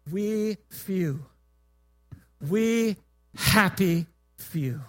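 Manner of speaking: slow, solemn close of a stage monologue, pitch sinking; Henry the Fifth. Acted. King to his outnumbered troops, slow solemn oration. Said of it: "he needs to inspire his troops to fight".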